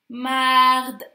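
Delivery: «Mard» fort, tenu sur une note; étirer «Mard» en appuyant la fin; syllabe étirée. Shown as a demonstration. Teacher sounds out the word start. («Mard» prolongé pour déchiffrer)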